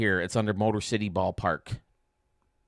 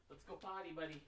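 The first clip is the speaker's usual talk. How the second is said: coaxing a puppy, higher and softer; sweet talk to the dog; high sing-song dog talk; 8 semitones higher, 18 dB quieter than usual. Real, not acted.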